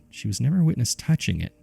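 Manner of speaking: ordinary delivery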